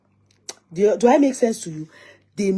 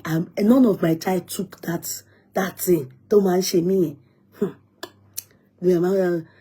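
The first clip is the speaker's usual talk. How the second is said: ordinary talk, nothing marked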